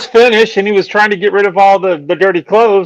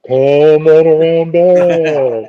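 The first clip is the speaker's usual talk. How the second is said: very slow, drawn-out beckoning; hammed-up sinister invitation; mock creepy voice; much slower than usual. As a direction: stretch every word like a horror-film host. Joking creepy version of the voice.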